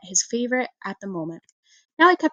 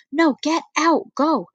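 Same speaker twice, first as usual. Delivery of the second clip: slow, drawn-out command to leave; stern order; 6 semitones higher, much slower than usual. A narrator's reading. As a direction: order him out, stretching each word. Sister ordering brother out.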